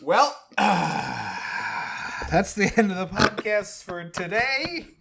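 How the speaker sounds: slow, high, sighing wrap-up; mock sign-off. Co-host ending the podcast after the gag, mock-defeated. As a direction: pretend to give up and close the show.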